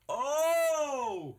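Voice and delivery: long, very high drawn-out "ohhh"; high mock wail held on one note; exasperated "oh". Friend tells how he reacted to Johnny's late change, exasperated high "oh".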